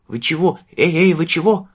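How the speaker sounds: high, loud alarmed calls; alarmed boy. A narrator's reading. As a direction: call out in sudden fright. Boy alarmed as man collapses. (испуганный мальчишка окликает)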